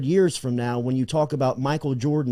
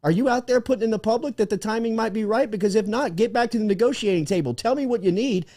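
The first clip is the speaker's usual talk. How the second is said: imitating the Baylor caller; 8 semitones higher than usual. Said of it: "Baylor picks up the phone and says".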